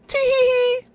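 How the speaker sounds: squeaky high tee-hee giggle; loud squeaky tee-hee. Pretend. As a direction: giggle like a cartoon imp. Giggly cartoon voice while gaming.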